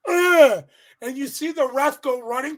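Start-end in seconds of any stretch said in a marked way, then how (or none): 0.1-0.6 high, loud pained grunt; mock pained grunt. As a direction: let out a hurt groan. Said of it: "Lashley's just like".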